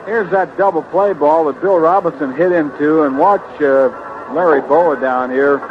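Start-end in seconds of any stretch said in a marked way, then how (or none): none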